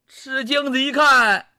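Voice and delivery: ordinary delivery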